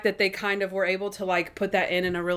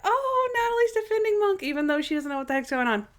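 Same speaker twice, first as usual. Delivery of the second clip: gushing; 12 semitones higher than usual. Retold or imitated. Her own reaction, retold.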